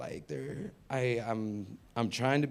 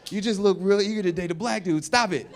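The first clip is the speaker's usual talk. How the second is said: mock scold; 5 semitones higher, 7 dB louder than usual.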